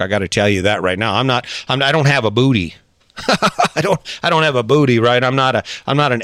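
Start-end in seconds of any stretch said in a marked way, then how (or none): 3.2-3.7 short higher-pitched chuckle; quick laugh at your own joke. Real, not acted. Podcaster joking about lacking a booty, amused laugh.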